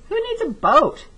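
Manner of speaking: lightly voiced cheerful dinosaur; playful dinosaur. A narrator's reading. Dinosaur line, read aloud.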